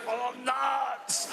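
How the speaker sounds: high, strangled mock shout; hammed-up last gasp, word stretched out; dying cry. Passenger gasping out the cause as she dies, strangled cry.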